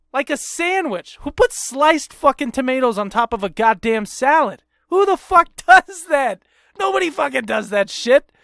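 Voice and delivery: high-pitched incredulous tirade; outraged rant. Real, not acted. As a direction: climb into squeaky, exasperated disbelief. Ranting about a botched salad order.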